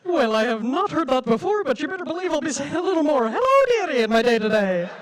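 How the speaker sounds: exaggerated dotty housekeeper voice; Missus Doubtfire. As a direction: play up a hammy nanny, swooping high on the greeting. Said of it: "Missus Doubtfire?".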